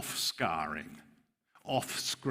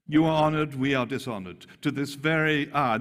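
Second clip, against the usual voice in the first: mild mocking; 5 semitones higher, 7 dB louder than usual. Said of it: "He's mocking them".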